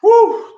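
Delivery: high, loud "woo" of relief; sharp high post-workout woo; winded whoop. Real, not acted. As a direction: whoop out a big breath after exertion. Recovering after the workout round.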